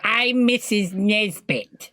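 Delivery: high, drawn-out character voice; Mrs Nesbit impression. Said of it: "I thought you was gonna go".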